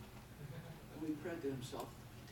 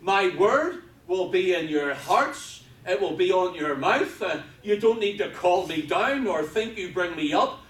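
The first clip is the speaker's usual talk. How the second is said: loud, high-pitched preacher's cry; preaching at full force; 9 semitones higher, 14 dB louder, slower than usual. Real, not acted. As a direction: proclaim it at full volume to the congregation.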